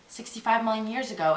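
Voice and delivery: normal talking voice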